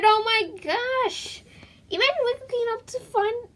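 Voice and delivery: high, stretched-out oh my gosh; shocked gasp. Acted. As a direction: gasp as if the news just hit you. Gasping at the twist.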